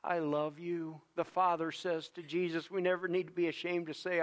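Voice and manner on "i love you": tender. Shown as a demonstration. Repeating the Father's words.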